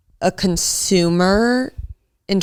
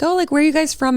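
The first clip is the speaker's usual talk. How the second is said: higher-pitched, eager manager voice; chatty manager; 10 semitones higher, faster than usual. Retold or imitated. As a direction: imitate a manager greeting guests. Acting out manager greeting tables.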